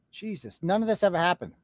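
ordinary talk, nothing marked

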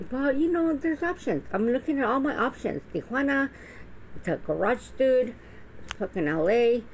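high-pitched excited run through the options; excited listing. Real, not acted.

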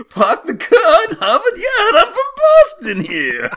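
put-on very high, loud, slow laughing Boston accent; mock Boston accent. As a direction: stretch out a cartoon Boston drawl. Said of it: "That voice keeps getting sharper and higher".

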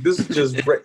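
ordinary delivery

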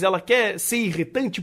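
ordinary talk, nothing marked

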